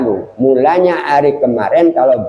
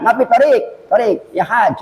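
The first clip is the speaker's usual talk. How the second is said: high, quick mock Saudi Arabic; imitating Saudis; 8 semitones higher than usual. Preacher mimics locals telling pilgrims no road, mock Saudi Arabic. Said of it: "Ini orang-orang sana".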